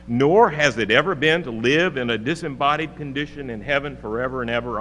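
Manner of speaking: ordinary delivery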